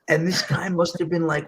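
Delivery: ordinary delivery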